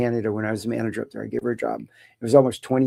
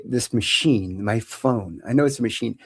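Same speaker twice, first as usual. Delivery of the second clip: ordinary delivery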